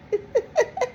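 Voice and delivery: high burst of laughter; a squealing laugh. Real, not acted. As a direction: laugh up high at her own cheek. Laugh after cursing.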